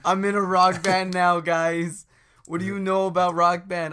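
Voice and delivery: loud, cocky announcement; boastful rocker. Pretend. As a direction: declare it like a proud band frontman. Plays boastful rocker character.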